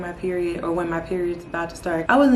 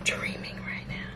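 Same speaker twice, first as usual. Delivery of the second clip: hushed, breathless whisper of disbelief; stunned whisper; 10 dB quieter, slower than usual. Real, not acted. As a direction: whisper it as if you can't believe your eyes. Stunned by a pregnancy test.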